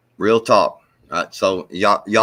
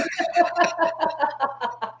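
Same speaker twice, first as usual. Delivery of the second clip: high giggle; 6 dB quieter than usual. Real, not acted. Laughs retelling Santa story.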